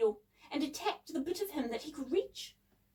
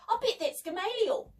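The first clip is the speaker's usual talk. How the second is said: high, alarmed guess; startled and high; gasped; 10 semitones higher than usual. A narrator's reading. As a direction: gasp it out, pitched well up. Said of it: "gasped Debbie".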